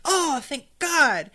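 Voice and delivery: acted-out gush of relief; mock relief. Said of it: "he starts to be really relieved".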